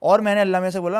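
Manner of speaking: ordinary talk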